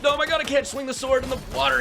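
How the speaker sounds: high, loud, fast yell of frustration; frustrated shout. Real, not acted. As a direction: blurt it out, panicking in the game. Can't fight underwater in game.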